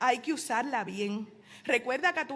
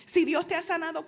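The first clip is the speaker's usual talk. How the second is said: normal talking voice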